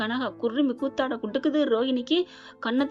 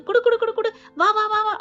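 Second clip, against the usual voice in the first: much higher and louder, gleeful repeated demands; eager childlike chatter; 10 semitones higher, 6 dB louder than usual. Retold or imitated. Childlike bride drags her husband to the sofa, excited high voice. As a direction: bounce through the words like an excited child.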